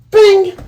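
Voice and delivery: high sharp ping; slingshot ping. Retold or imitated. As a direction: snap out the shot sound. Slingshot sound effect.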